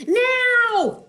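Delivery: very high, loud demand; shrieked order; Wanda. Acted. Wanda orders the Wizard, very high and loud. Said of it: "said Wanda".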